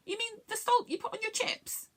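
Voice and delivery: high-pitched query, rising at the end; Rusin's puzzled voice. A narrator's reading. Rusin checks what salt Longstint means, puzzled. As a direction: ask it high, checking you understood.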